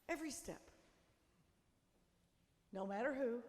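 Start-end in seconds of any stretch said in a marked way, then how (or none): 0.1-0.6 a quieter echo of every step, dropping away; soft echo. Acted. Preacher echoing the promise to the congregation, softer. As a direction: let it fall back, soft and close.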